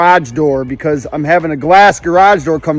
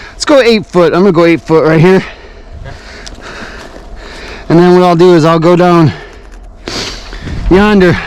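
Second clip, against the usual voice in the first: usual speaking voice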